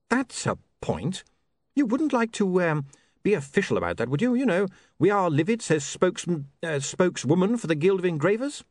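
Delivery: awkwardly hopeful character voice; hesitant, hopeful William. Acted. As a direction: float the idea haltingly, pitch lifted.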